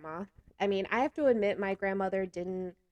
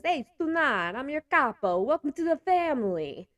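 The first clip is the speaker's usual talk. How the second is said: higher, louder mock mobster welcome; mafia capo voice; 7 semitones higher, 5 dB louder than usual. A capo welcomes the new boyfriend, hammed-up mobster voice. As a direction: play the mob boss greeting a new member. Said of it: "meme that says".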